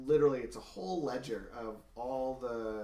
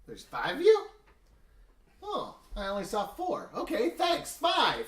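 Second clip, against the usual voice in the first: goblin voice; 10 semitones higher, 6 dB louder than usual. Acted. GM voicing goblin NPC.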